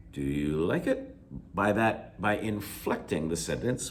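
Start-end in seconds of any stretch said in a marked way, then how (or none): 0.1-1.2 "Do you like it" starting low, climbing steeply at the end; rising question inflection. Shown as a demonstration. Teacher translating the Korean question, rising inflection. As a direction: lift the voice up through "like it". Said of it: "putting your voice up".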